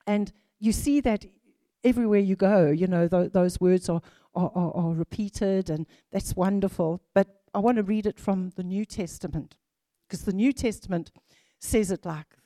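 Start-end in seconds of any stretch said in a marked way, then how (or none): none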